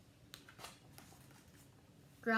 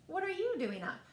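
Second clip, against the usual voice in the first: Turkey voice; 7 semitones higher, faster than usual. Acted.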